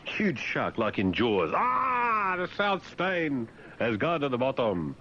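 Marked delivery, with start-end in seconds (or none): 1.6-4.9 high, drawn-out cry of mock alarm; mock disaster cry. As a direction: call out a pretend sea disaster. Acts out shark attack at sea.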